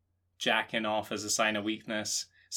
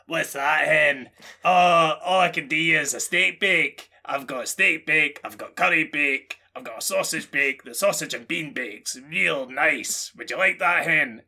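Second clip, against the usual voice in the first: hammed-up Scottish shop lady, louder and higher; Scottish shop worker; 3 semitones higher, 4 dB louder than usual. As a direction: sell him the bake like a chatty Scottish server. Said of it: "hen (Greggs server in Scotland)".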